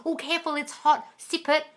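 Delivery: put-on high, bossy little-kid warning; child serving pretend tea. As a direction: warn like a toddler pouring hot pretend tea. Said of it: "They really think ... from their teapot".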